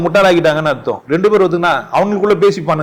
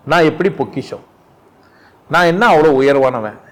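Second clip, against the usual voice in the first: ordinary delivery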